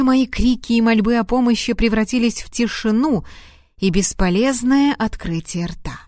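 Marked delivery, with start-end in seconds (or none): none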